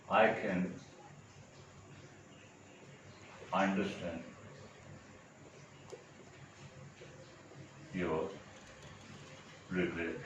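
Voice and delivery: low, soft words with very long gaps; word-by-word dictation. Shown as a demonstration. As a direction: murmur it piece by piece as if writing it out. Spelling out an example while writing.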